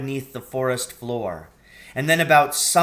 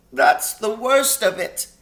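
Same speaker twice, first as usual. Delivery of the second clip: groaning; 9 semitones higher, 6 dB louder than usual. A narrator's reading. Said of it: "with a deep groan".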